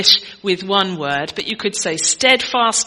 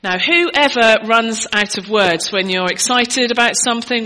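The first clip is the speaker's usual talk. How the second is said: normal talking voice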